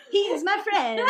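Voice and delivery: high mock-proud claim of friendship; silly put-on voice. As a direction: ham it up in a high joke voice. Finishing the joke in put-on voice.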